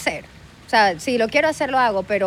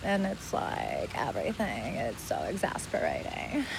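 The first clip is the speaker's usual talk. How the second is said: slow, fading Los Angeles drawl; LA accent from the back of the mouth; 2 semitones lower, 6 dB quieter, much slower than usual. Shown as a demonstration. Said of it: "El de ley. It's like from the back of your mouth". (acento de Los Ángeles arrastrado)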